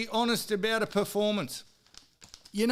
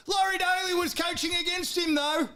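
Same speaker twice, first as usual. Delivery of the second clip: sudden outburst; 7 semitones higher than usual. Real, not acted. Outburst about the coach.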